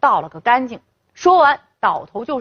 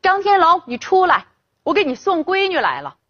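calling out; 7 semitones higher than usual. A narrator's reading. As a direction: yell for him to come out. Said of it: "一进院子就嚷嚷起来了".

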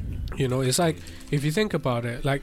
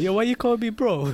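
lightly voiced sister, higher pitched; higher-pitched mock complaint, quoting the sister; imitating sister; 8 semitones higher, 3 dB louder than usual. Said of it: "my sister would be like".

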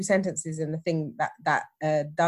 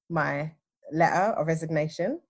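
ordinary delivery, nothing marked

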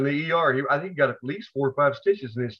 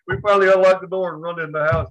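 ordinary delivery

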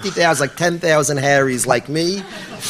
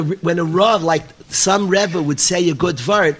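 ordinary talk

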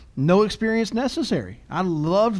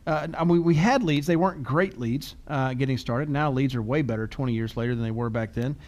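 ordinary delivery

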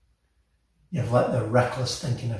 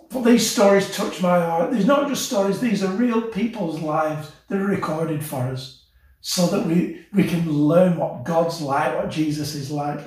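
normal talking voice

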